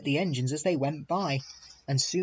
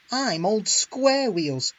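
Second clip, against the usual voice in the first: slow, louder, higher fuming; 7 semitones higher, 5 dB louder, slower than usual. A narrator's reading. Henry fuming to the Fat Controller, slow and loud. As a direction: fume at the insult. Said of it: "fumed Henry".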